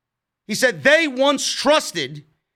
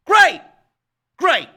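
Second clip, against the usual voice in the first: sarcastic approval; 7 semitones higher, 6 dB louder than usual. Real, not acted. Dismissing the group's cool disguised voices.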